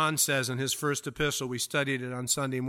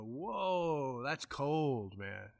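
mock sympathy; 2 semitones lower, 10 dB quieter, much slower than usual. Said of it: "You go".

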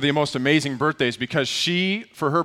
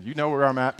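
normal talking voice

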